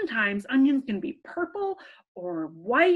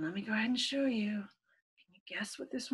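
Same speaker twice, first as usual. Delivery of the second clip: hushed secret whisper; 3 semitones lower, 10 dB quieter, faster than usual. Acted. Guessing game suspense for kids.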